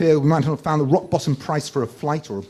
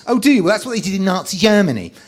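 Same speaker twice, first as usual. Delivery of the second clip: mock smug arguer; 6 semitones higher, 3 dB louder, faster than usual. Voicing Nazi-invoking arguer.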